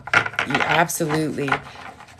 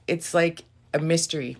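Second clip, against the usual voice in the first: usual speaking voice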